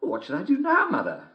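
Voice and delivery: put-on very high, girlish and bright; little girl voice. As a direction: play the small girl at the door, loud and high.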